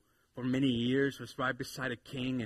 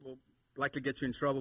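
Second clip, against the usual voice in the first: normal talking voice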